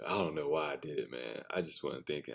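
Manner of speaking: low, soft mumbled excuse; sheepish mumble. Retold or imitated. Son mumbling an excuse to dad, soft and low. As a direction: mumble like a guilty kid.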